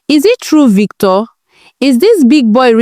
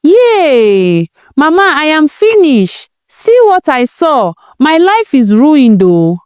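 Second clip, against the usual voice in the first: acted wailing; 6 semitones higher than usual. A narrator's reading. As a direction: cry out to Mama, without real sobs. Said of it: "crying with all her strength".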